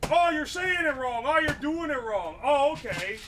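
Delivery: mocking nitpickers. Streamer mimicking chat nitpicking the wording, in a high mocking voice.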